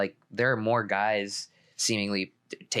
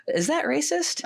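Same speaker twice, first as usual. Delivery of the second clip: mock worry; 3 dB louder than usual. Joking about getting canceled.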